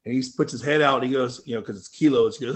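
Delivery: usual speaking voice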